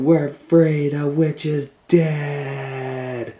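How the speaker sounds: high, loud, slow triumphant shout; joyful cry. Acted. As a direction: celebrate the witch's death. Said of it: "And Gretel said".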